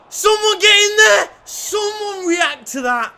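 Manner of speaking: high, loud yell at the screen; excited shout. Real, not acted. Gamer yelling at his team on screen, loud and high. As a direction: bellow at the players to react. Urging players in a football game.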